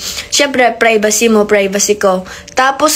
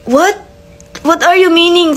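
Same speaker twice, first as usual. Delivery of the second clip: shocked what; 6 semitones higher than usual. Retold or imitated. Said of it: "sabi ko".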